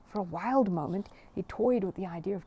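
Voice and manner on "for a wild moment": usual speaking voice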